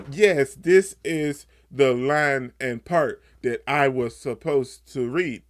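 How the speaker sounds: slow, loud wooden script reading; lifeless line reading. Retold or imitated. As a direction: imitate a bad actor stiffly reciting lines, slowly. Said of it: "read the lines with a little life".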